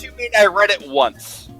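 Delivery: normal talking voice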